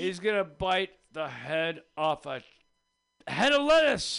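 hammed-up ringmaster build. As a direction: hype the crowd up to the big bite. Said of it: "announcing act".

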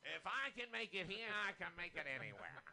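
put-on tinny old newsreel announcer voice; booming like a vintage newsreel. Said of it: "Why do I sound like one of those old newsreels".

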